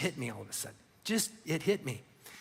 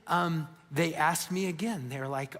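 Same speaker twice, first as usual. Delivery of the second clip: ordinary talk, nothing marked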